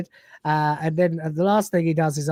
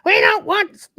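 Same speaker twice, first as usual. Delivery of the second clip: mock shout; 6 dB louder, slower than usual. Group chanting Monty Python spam sketch.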